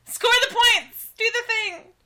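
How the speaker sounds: high-pitched playful cheer; mock cheering. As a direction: cheer like a clueless fan.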